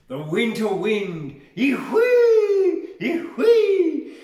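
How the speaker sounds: loud high wind howls with a laugh; howling wind. Acted. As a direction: shout the wind noise, high. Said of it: "I want to do that again".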